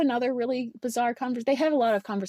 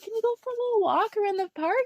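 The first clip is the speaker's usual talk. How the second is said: high, fast lovestruck suitor; mock suitor voice; 9 semitones higher, much faster than usual. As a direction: ham up the nervous suitor. Voicing the hero in a movie.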